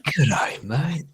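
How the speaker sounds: put-on playful Aussie accent, lifting at the end; Australian accent. Host trying the greeting for a friend, in an Australian accent. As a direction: greet them like an Australian, close to laughing. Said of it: "can you do an Australian accent?".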